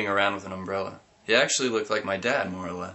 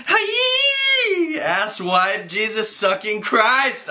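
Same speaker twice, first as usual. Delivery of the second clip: high, loud panicked scream; 13 semitones higher, 7 dB louder, much slower than usual. Acted. Narrator scared by a green hand, screaming. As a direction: shriek it at the mannequin. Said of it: "I screamed".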